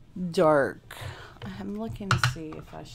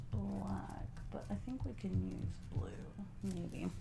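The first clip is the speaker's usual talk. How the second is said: whispered aside to herself; a soft whisper while she works; 3 semitones higher, 10 dB quieter than usual. Real, not acted. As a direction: breathe it out unvoiced.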